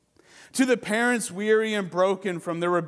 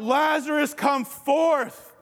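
cried out; 6 semitones higher, slower than usual. Retold or imitated. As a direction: call him out of the grave. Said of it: "And he cried out".

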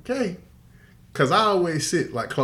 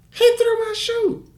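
high, slow tattling whine; girl complaining to the row in front; mock whining girl; slower than usual.

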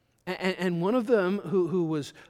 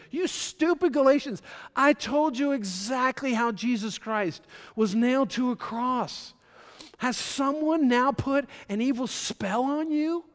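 higher and loud, slowing down, indignant; ticked-off Paul; 7 semitones higher, 5 dB louder than usual. Retold or imitated. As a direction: scold the church like an angry apostle. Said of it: "Paul is ticked here".

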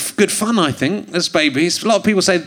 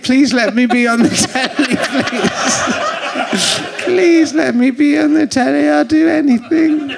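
hammed-up begging, higher; pleading for TV; 4 semitones higher than usual. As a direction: beg to be on telly. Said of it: "Massive twat".

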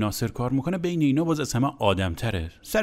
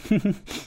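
imitated burst of laughter; 8 semitones higher than usual. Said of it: "واز دوباره زد زیر خنده".

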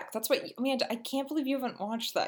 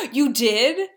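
gasp, then a much louder, higher squeal of disbelief; excited gasp; 7 semitones higher, 12 dB louder than usual. Real, not acted. Friend just heard Tickled was watched, excited loud disbelief. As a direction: burst out in shocked delight.

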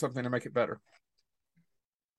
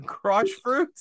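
incredulous; 11 semitones higher, 4 dB louder than usual. Real, not acted. Repeats nickname, incredulous.

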